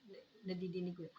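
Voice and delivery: ordinary delivery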